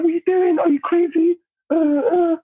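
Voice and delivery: high-pitched mock outrage; imitating crazed woman. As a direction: mimic her ranting way up high. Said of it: "she's getting crazy".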